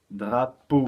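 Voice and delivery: drapeau split as DRA, PEAU; syllable by syllable. Shown as a demonstration. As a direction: land firmly on the PEAU of Drapeau. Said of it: "par la même syllabe".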